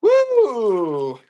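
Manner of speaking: high, loud falling "woo"; excited whoop. Real, not acted. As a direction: let out a celebratory whoop. Seller whoops during the sale.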